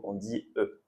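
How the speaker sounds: ordinary talk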